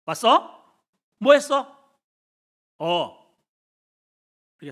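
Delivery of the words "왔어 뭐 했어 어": blunt, businesslike greeting; curt home voice. Retold or imitated. A touch of family greeting at home, curt. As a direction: toss it off dryly. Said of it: "진짜 모습은".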